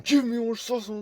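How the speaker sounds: high, loud whiny demand; mock demanding customer. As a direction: squeak it out like a pushy customer.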